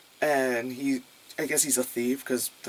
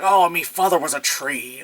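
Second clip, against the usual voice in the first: loud, hammed-up Irish brogue; mock a terrible movie Irish accent; big cartoon Irish voice; Seamus's bad Irish accent; 3 semitones higher, 6 dB louder than usual. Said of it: "Irish accent is so bad, he sh-, sounds like Seamus".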